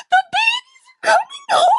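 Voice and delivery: a squeaky, excited squeal about the babies; squeaky high pitch. Retold or imitated. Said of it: "it was that squeaky high pitch".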